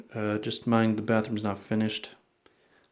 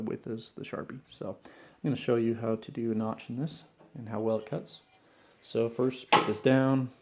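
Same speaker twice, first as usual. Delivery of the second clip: ordinary talk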